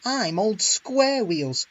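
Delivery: slow, loud and high fuming. A narrator's reading. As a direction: fume over each word. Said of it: "fumed Henry".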